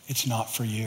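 God's quiet voice. Retold or imitated. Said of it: "God just kept saying".

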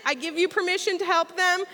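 ordinary talk

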